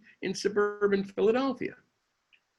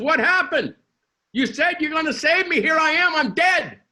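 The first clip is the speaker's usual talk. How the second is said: higher, louder outraged protest; indignant complaint; 9 semitones higher, 6 dB louder than usual. Acted. Dead woman demanding answers from God, high indignant complaint. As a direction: confront him, betrayed and indignant.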